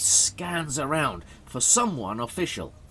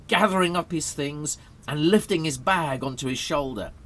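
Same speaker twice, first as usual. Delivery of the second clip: ordinary delivery, nothing marked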